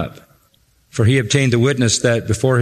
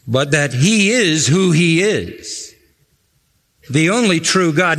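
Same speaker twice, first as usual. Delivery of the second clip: normal talking voice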